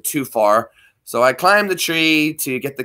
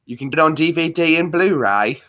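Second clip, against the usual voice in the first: usual speaking voice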